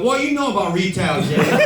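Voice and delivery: put-on lightly accented, scoffing retort; Tommy. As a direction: mimic the filmmaker's indignant comeback. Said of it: "He's like".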